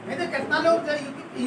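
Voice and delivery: ordinary delivery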